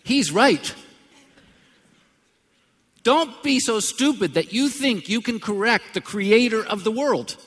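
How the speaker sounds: loud, high, emphatic preaching; slow booming pulpit warning; preaching at full force. Acted. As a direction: drive it home like a revival preacher.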